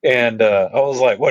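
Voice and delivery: usual speaking voice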